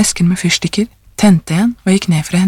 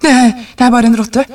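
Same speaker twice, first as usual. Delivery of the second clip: put-on faltering, slightly raised old man's voice; trembling voice; 5 semitones higher, 4 dB louder, faster than usual. A narrator's reading. Old man explains away the knocking, trembling voice. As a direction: stammer the excuse with a tremble. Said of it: "sa den gamle mannen med skjelvende stemme".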